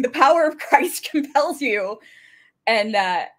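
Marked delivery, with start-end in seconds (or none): 0.0-2.0 mild mock yell broken by laughter; retold scream. Retelling Laura Lee's exorcism with a Bible, laughing mock scream. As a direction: raise the pitch, laughing through the cry. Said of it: "starts screaming".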